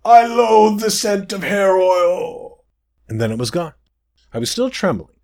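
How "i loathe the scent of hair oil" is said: slow, high, disgusted wail; 嫌悪する魔物の声; disgusted demon. Acted. Demon trapped in hat.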